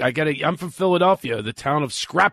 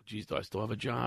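sheepish aside; 4 semitones lower, 13 dB quieter than usual. Retold or imitated. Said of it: "I'm going".

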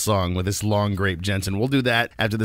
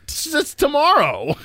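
mock surprise; slower than usual. Real, not acted. Joking about the date.

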